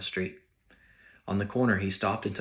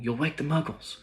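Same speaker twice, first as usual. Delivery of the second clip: urgent scolding; 6 semitones higher than usual. Acted. McGonagall scolding Hagrid.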